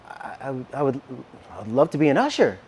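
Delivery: hesitant, stammering start, then quickly out; imitating his own stunned, softly halting answer; blown away. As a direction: stumble on the first words, overwhelmed. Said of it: "This literally, just like this. I'm just, I'm blown".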